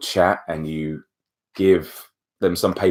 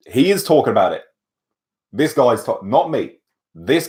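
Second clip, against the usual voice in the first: raised, higher, emphatic protest; mock disclaiming; 6 semitones higher, 4 dB louder than usual. Disowning bomb talk in the embassy, mock-alarmed disclaimer. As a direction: announce it for any listeners, pinning blame elsewhere.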